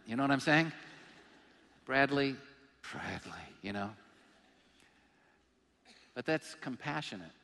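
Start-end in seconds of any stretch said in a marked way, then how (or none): 1.9-3.9 disappointed mom. Retold or imitated. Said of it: "a tone of disappointment in it".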